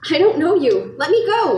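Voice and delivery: high, loud scared cry; terrified protest to a stranger; frightened shout. Acted. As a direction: push a stranger away in fear.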